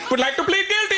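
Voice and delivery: put-on high-pitched comic Indian accent. Lawyer breaking courtroom tension, high-pitched Indian accent. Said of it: "pop in the Indian accent".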